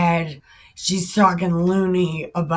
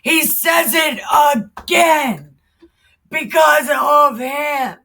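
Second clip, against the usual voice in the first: angry shout; 8 semitones higher, 8 dB louder than usual. Real, not acted. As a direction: yell back at the screen in outrage. Angry rant at the clip.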